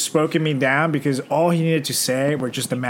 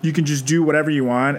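normal talking voice

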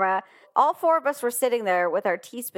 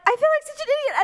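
crying to herself; much faster than usual. Retold or imitated. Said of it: "And I start crying".

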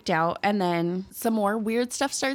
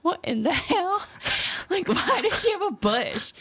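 high, laughing mock disbelief; shocked outburst; 10 semitones higher than usual. Mom shocked at bath time, high laughing disbelief. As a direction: squeal it out half laughing, like a scandalized mom.

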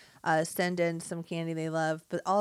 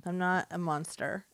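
ordinary talk, nothing marked

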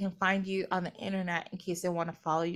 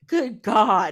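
ordinary talk, nothing marked

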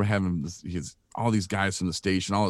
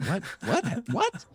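each what climbing much higher; baffled, rising higher each time; retold confusion; 3 dB quieter, slower than usual. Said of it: "real confused what was going on".

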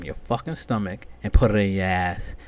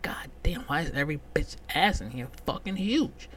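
breathless whisper, higher; whispered aside; 7 semitones higher, 4 dB quieter than usual. Retold or imitated. At the gym, he mutters to himself in a whisper. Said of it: "I'm like".

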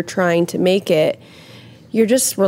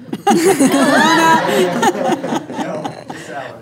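real burst of laughter; long laugh; 9 semitones higher than usual. Real, not acted. Laughing at her own joke.

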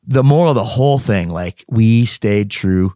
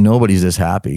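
usual speaking voice

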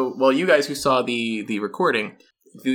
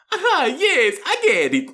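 much higher, louder rat voice; Crispy Rat voice; 3 dB louder than usual. Acted. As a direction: squeak it like a pleased little rat. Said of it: "Crispy Rat's like".

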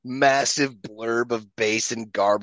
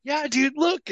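much higher, goofy self-imitation showing off; mock proud; slower than usual. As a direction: squeak it like a proud kid. Showing the tooth to friends.

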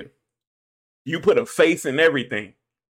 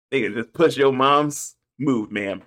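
ordinary talk

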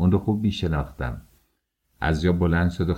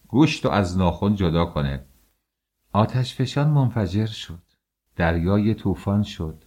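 ordinary delivery

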